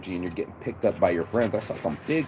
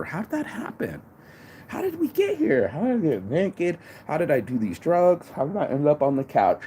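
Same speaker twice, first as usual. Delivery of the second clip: high, baffled rhetorical questions; mock bewilderment; 6 semitones higher than usual. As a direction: act dazed and amazed at how you got here. Mock bewildered girl after meme.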